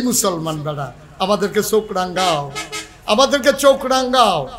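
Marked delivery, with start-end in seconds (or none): 3.1-4.5 raised, higher repeat of a challenge; forceful rhetorical challenge; defiant challenge. Real, not acted. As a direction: throw it at the enemy from the pulpit.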